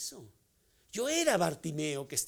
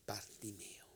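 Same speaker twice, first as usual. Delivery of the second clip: softer, voiceless name; whisper; 6 semitones lower, 12 dB quieter, slower than usual. Acted.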